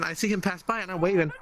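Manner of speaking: usual speaking voice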